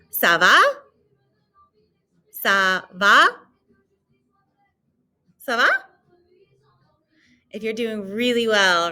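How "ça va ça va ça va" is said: "Ça va?" modelled with rising question; model phrase. Shown as a demonstration. Teacher models the phrase for students to repeat. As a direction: say "Ça va?" clearly for repetition. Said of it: "répétez".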